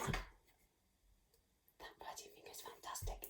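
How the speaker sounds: slow hushed whisper. Real, not acted. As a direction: whisper the praise slowly. Whispered praise.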